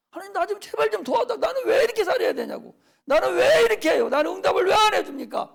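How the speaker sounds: a preacher loudly acting a desperate plea in prayer; crying out to God. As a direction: cry out to God as a desperate believer. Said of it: "울부짖어야 돼".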